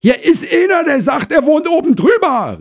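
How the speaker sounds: very high, loud holler; shouting back. Acted. Wife to husband inside the flat, shouting back shrilly high. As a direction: yell the news through the flat. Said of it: "rief Frau Krause zurück".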